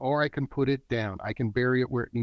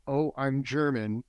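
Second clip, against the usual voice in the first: understated burdened admission, near usual voice. Acted. German man facing his inherited stigma, understated and burdened. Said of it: "so then there's this thing".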